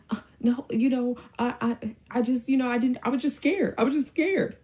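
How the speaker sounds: high, stammering whimper; mock his tearful excuse; whiny put-on crying voice, faltering; fake crying. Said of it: "Fake crying".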